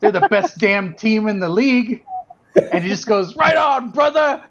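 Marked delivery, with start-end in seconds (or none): 0.0-2.0 higher-pitched, retold fan boast; emphatic cheering. Retold bold answer to bouncer. 3.4-4.4 high, loud imitation of a delighted bouncer; approving shout.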